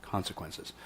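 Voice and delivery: low, hushed one-word reminder; very soft and low, under the breath; whispered. Retold or imitated. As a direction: whisper it like a mentor's quiet warning. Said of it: "He would whisper it".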